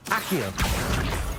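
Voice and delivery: spell incantation. Acted. Student summoning a gobstone, calls the spell out firmly. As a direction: snap the charm out to pull it in.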